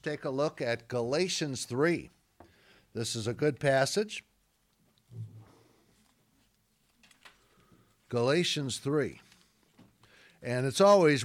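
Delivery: loud, emphatic preaching, hammering key words; preaching at full force. Acted. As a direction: preach it out to the whole room.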